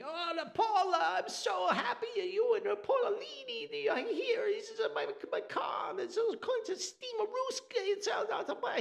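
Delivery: high, frantic Italian neighbor voice; Italian accent. Retold or imitated. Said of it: "the, the Italian thing".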